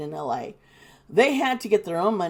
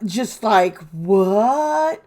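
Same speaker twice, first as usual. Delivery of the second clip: loud, drawn-out incredulous question; real appalled bewilderment; stunned disbelief; 5 dB louder, much slower than usual. Real, not acted. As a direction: react aghast, stretching the word. Disbelief at the woman's behaviour.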